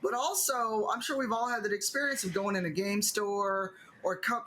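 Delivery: ordinary talk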